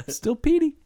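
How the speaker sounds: high, sweet mock voice. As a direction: play the sweet boyfriend. Voicing Peter mockingly.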